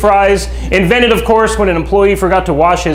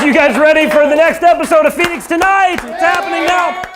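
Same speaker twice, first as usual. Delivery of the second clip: high, shouted host call to the crowd; rally the audience, pitch climbing as you go; hyped show opener; 13 semitones higher than usual. Real, not acted. Host launching the next episode of Phoenix Tonight, excited shout.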